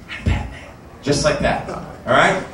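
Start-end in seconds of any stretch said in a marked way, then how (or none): 0.1-0.5 hushed, toneless mock superhero rasp; softly growled hero introduction; Michael Keaton Batman. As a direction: whisper it like the Batman of the movies. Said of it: "doing a Michael Keaton impression".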